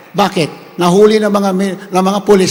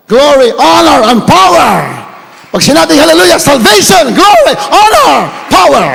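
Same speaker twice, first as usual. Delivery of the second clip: shouted praise words; 6 semitones higher, 6 dB louder than usual. Shown as a demonstration. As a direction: cry each word out to the rafters. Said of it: "Pag sinigaw mo yan na malakas".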